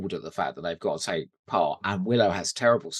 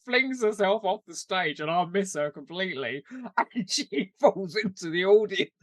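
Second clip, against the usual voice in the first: high giggly storytelling; laughing while telling; 8 semitones higher than usual. Real, not acted. Recounting a friend falling off stage, laughing high. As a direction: laugh through the punchline.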